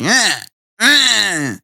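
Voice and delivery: put-on high childish fussing noise; imitated bratty wail; mock whine. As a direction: whine wordlessly like a sulking kid. Imitates upset girl's whining.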